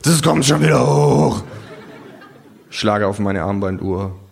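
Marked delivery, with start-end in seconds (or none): 0.0-1.4 put-on bawling drunk. A narrator's reading. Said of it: "gröle ich".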